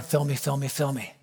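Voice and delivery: low, soft, repeated plea; praying under breath. Retold or imitated. Preacher to God while being pushed, praying under his breath. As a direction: pray it quietly to yourself. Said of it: "and I'm praying".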